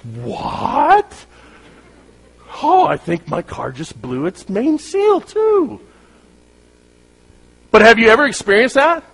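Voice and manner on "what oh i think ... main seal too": high squeal of mock delight; mock surprise. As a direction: gasp it out in fake astonishment. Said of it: "You're like".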